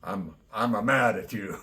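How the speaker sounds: put-on loud, playful Italian accent. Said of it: "That Italian accent".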